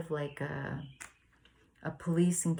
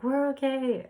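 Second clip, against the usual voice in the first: much higher, bright sing-song no thanks; polite refusal; 10 semitones higher, 5 dB louder than usual. Retold or imitated. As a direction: chirp it back, friendly and high. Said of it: "And I'm like".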